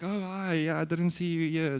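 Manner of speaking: high, bright feigned surprise greeting; fake surprise. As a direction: act like you just bumped into him. Acting fake chance encounter.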